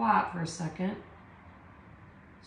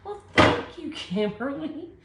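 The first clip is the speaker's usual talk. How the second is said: high, louder burst of gratitude; delighted thanks; 7 semitones higher, 5 dB louder than usual. Real, not acted. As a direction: thank a viewer with real delight. Thanking a viewer for a compliment.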